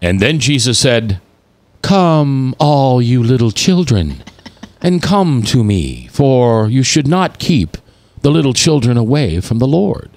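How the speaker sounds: slow, gentle, reverent Bible voice; lightly mocking holy reading voice; pious scripture reading. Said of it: "they always read it like".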